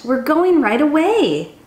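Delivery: ordinary delivery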